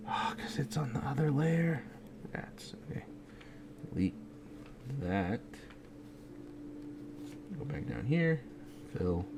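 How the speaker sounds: unvoiced whisper to himself. Real, not acted. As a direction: breathe it out as a private aside. Muttered aside while drawing.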